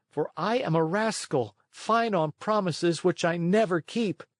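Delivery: put-on a guilty boy fretting aloud; Pinocchio to himself. A narrator's reading. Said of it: "he said to himself".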